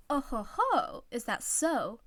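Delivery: knowing oh ho ho; suggestive tease. Acted. Teammate teasing the couple, suggestive and knowing. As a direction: waggle the words, insinuating. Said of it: "wiggled both eyebrows now, suggestively".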